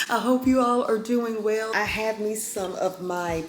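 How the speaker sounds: normal talking voice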